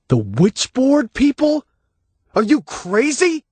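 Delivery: high, slow disbelieving shout; can't believe what he hears; incredulous cry. Acted. Dave shouts from the grave.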